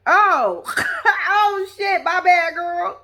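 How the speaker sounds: laughing Sean. Retold or imitated. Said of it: "He was like".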